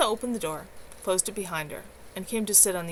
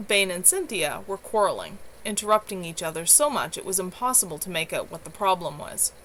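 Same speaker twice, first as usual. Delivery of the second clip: ordinary delivery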